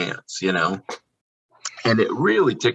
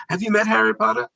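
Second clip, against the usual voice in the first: quick, higher eager guest question; a guest asking; 13 semitones higher, faster than usual. Retold or imitated. A fan quizzes the wand keeper, quick high question. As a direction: rattle it off like an excited fan. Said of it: "I was like".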